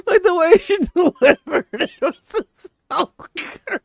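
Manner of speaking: high voice through real laughter; talking while still laughing. Real, not acted. Gamer praising the teammate's line, high and laughing.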